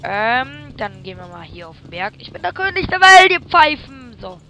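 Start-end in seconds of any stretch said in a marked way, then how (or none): 2.2-4.2 loud high mock boast; roaring tyrant king. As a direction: bellow like a gloating king. Boasting gamer outburst.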